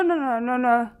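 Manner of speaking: ordinary delivery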